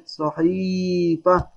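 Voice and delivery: slow صحيفة with a long "ḥiii"; madd with ya. Shown as a demonstration. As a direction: stretch the ee in صحيفة. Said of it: "بعدها مد بالياء".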